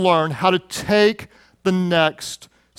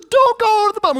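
put-on much higher little-boy voice begging; pleading scared child; 13 semitones higher, 3 dB louder, faster than usual. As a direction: beg dad not to hit the bump. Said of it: "He said".

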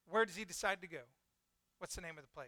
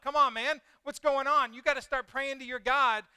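loud, higher acted urgency; urgent rousing; 6 semitones higher, 10 dB louder than usual. Sailors waking Jonah to pray, loud urgent demand. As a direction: rouse a sleeper with loud urgency.

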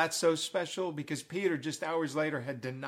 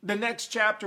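ordinary talk

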